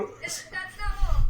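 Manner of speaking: booming TV salesman's tease; infomercial pitchman. Acted. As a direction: sell it like a TV ad host. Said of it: "Okay, Billy Mays".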